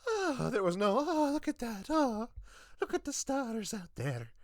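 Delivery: mock grandma. Mocking the ad's grandma gazing in wonder. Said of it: "grandma voice again".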